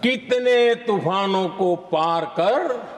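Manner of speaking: slow, measured couplet with long pauses; poetry recitation. Acted. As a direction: recite the sher line by line, swelling on the repeat. (शायराना अंदाज़ में शेर पाठ)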